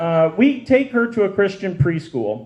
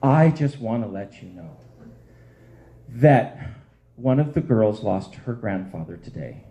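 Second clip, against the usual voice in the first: gentle teacher; 8 semitones lower than usual. Retold or imitated. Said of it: "Miss Sherry, who is a wonderful woman, and she goes".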